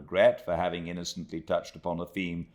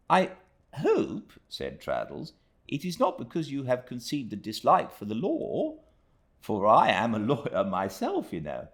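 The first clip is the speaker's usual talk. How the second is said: Traddles voice; 8 semitones higher than usual. A narrator's reading.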